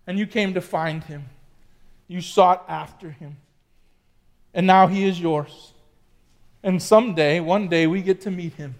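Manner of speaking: hushed, reverent prayer. Acted. Pastor leads a prayer.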